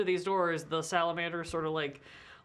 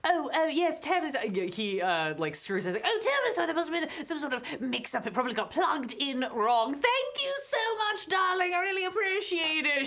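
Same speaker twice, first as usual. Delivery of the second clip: much higher, fawning and gushing; gushing clerk; 9 semitones higher than usual. Acted. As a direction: fawn over her sweetly, higher pitched. Clerk fawns over the escort.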